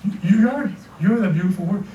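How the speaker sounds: normal talking voice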